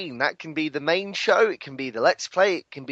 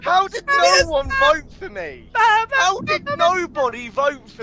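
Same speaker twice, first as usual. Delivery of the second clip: high, loud indignant protest; outraged wail; 13 semitones higher, 5 dB louder, slower than usual. Real, not acted. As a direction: wail it like you were robbed. Gets zero votes, protests.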